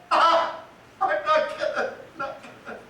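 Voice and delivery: the rage collapses into broken laughing sobs; breaking down. Acted. After raging at God, a collapse into broken sobs. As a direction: let the shout crumble away.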